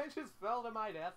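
high, loud, amused outburst; laughing disbelief. Real, not acted. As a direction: laugh into it at your own blunder. Laughing at own game death.

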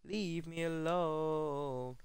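slow, stretched-out moan; mock whine. Real, not acted. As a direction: drag it out in a fed-up whine. Second take drawn out.

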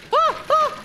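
short, high, loud shrieks; startled yelps. Real, not acted. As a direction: yelp twice in sudden alarm. Startled by danger in game.

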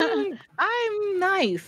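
high, slow innocent protest; mock innocent. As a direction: play it sweet and harmless.

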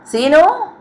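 loud, high call across the house. A narrator's reading. Sister calling for Seenu after school, loud call. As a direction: shout his name to find him. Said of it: "కేక పెట్టాను".

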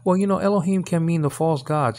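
high, mocking the know-it-all objector; mock objection. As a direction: brush off an imagined student's comeback. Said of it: "And don't give me".

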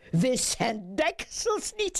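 high, breezy no; merry judge waving off the offer; jovial refusal. A narrator's reading. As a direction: refuse with playful firmness.

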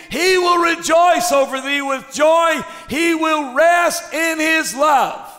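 loud, high preaching cry; full-force preaching. Acted. Preacher thundering to the congregation, high and loud. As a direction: preach it out to the crowd.

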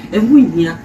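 ordinary talk, nothing marked

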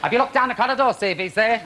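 high, loud, put-out query; high calling out. Acted. Host calling to the crew for Paul, high and loud. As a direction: call down the corridor. Asking for missing star.

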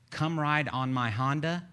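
each word spaced out slowly; unhurried teaching pace; slow, deliberate. Shown as a demonstration. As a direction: say it slowly and clearly, like coaching someone. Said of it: "but real fast over and over".

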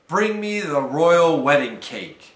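big, booming order; loud command. A narrator's reading. Baker sending son for the cake, commanding loudly.

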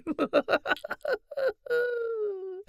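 a high, amused burst of laughter. Acted. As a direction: laugh out at the fake owl. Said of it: "Then she started laughing".

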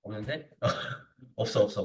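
ordinary talk

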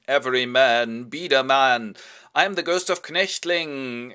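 slow, loud, hammed-up ghost voice; haunt him in a drawn-out, booming way; mock ghost. Schmitz playing a ghost to scare Biedermann, hammed-up and slow. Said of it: "plays a ghost".

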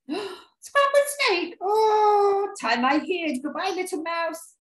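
high, loud storybook panic; hammed-up frightened farewell; alarmed snake. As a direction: squeal in mock terror, stretching the words. Snake takes fright and flees.

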